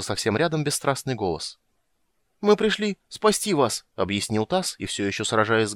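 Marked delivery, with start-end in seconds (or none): 2.4-3.8 high, slow explanation; high-pitched, drawn-out earnestness; eager little character. A narrator's reading. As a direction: announce the rescue in a high voice. Dazed Tas explains himself to rescuer.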